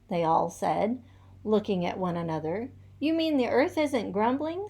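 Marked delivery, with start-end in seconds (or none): 3.0-4.7 high squawking disbelief; squawked. A narrator's reading. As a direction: squawk it like a startled goose. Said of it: "squawked Loosey Goosey".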